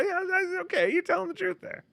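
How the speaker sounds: soft, very high squeak; quick, high, teasing concession; high mimicking voice. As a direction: echo the puppet's lying falsetto. Answers the high lying demonstration.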